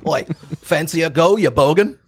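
mock Australian bloke picking a fight; mock Aussie. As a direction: jeer like a brawling Aussie. Mock Australian kangaroo challenge.

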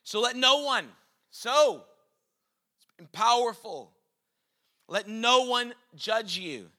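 loud, slow emphatic preaching. Acted. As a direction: preach it with force. Preaching at full force.

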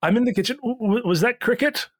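high, flustered call from the kitchen; flustered innkeeper. Acted. Pierre calling from kitchen.

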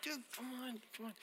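high, hushed pleading; embarrassed protest. Acted. Embarrassed guy to the friend who outed him, hushed protest. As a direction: plead under your breath for them to stop.

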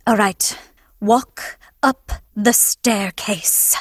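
slow, drawn-out teacher instruction; a hint of a droning acting teacher; droning teacher impression. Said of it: "my teacher was like".

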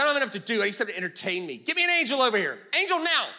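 high, loud bossy call for an angel; bored God summoning. Retold or imitated. Preacher voicing a bored God ordering an angel around. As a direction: play a bored God barking orders upward. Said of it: "He's like".

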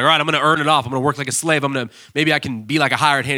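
a guilty son vowing to work like a slave; prodigal son. Retold or imitated. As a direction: son rehearsing how he'll earn his place. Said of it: "he's like".

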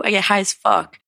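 normal talking voice

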